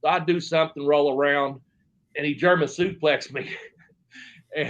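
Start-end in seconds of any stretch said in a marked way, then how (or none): none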